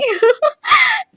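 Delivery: a sudden high giggle; bright teasing laugh; playful giggle. Real, not acted. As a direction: laugh off the cheeky refusal. Giggles after teasing viewers.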